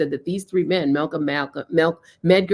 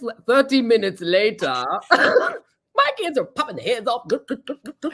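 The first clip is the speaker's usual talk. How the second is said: ordinary talk, nothing marked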